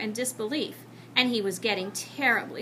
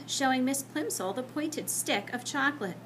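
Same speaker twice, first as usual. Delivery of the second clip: ordinary delivery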